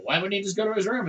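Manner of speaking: lightly voiced confused question; puzzled question. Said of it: "Austin's like".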